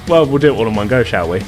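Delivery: ordinary talk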